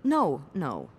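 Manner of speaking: normal talking voice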